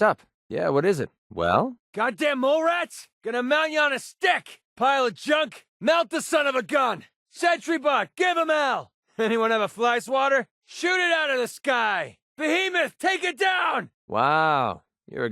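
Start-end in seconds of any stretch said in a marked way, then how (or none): 1.9-13.9 high yelled battle cries; combat shouts. Acted. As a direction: roar at the enemies.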